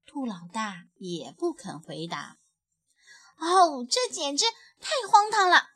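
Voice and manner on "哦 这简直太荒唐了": high, loud and fed up, stamping her foot; a small child's furious huff; indignant outburst. A narrator's reading. Little bunny stamping in anger at the insults, high and loud. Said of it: "小兔子气得直跺脚".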